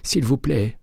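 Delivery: high, choked pleading voice; frightened clerk. A narrator's reading. Terrified clerk under McCaleb's arm, high and pleading. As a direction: beg to be let go.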